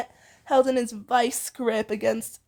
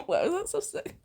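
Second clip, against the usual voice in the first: laughing; 5 semitones higher, 5 dB quieter than usual. Real, not acted. Laughing at the read line.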